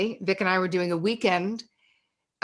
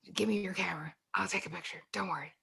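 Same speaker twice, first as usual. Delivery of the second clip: much softer, hushed offer; soft conspiratorial murmur; 12 dB quieter, faster than usual. Retold or imitated. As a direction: murmur it like a secret between friends. Friend sneaking a forbidden photo.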